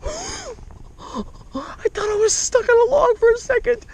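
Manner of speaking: high, slow, breathy amazement; real stunned excitement; breathless awe. Real, not acted. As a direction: still catching breath after the gasp. Landing biggest fish ever.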